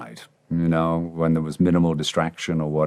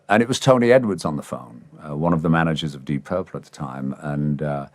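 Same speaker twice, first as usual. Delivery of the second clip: usual speaking voice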